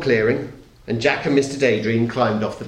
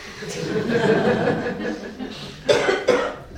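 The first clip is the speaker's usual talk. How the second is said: a breathless, amused chuckle; breathy chuckle; 9 semitones higher than usual. A narrator's reading. Narrator chuckles at crocodile.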